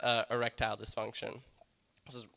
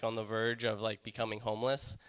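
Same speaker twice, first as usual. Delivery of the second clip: ordinary talk, nothing marked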